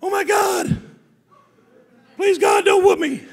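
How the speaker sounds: very high, very loud pleading scream; boy's own screams echoing over the school; mock scream. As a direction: shriek it, hamming up the terror. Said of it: "All of a sudden I hear".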